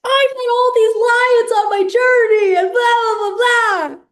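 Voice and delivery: hammed-up wail, high and loud; exaggerated loud, high-pitched sobbing complaint; mock crying. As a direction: whine it out like a tearful, put-upon character. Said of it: "he just starts crying".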